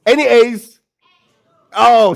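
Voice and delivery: usual speaking voice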